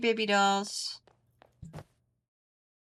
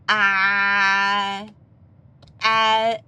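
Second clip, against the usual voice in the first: loud ah; 8 dB louder than usual. Acted. Loud exclamations.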